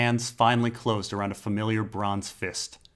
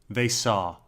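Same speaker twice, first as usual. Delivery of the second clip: ordinary delivery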